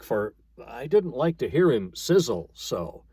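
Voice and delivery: ordinary delivery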